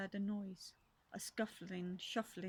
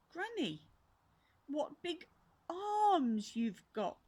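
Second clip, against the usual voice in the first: astonished; 7 semitones higher, 5 dB louder, slower than usual. Acted. Girl astonished.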